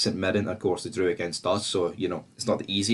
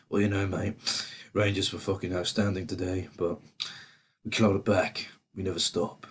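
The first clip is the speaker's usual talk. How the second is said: put-on Aussie accent; 2 semitones lower, 4 dB quieter than usual. Rangers fan mimicking the rival manager's post-match talk, mock Aussie accent. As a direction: imitate an Aussie manager talking, fairly quietly. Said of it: "Don't know if that was a good Aussie accent".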